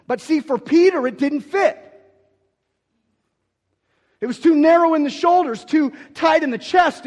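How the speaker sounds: impassioned sermon climax; full-force preaching. Acted. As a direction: preach it out to the back row.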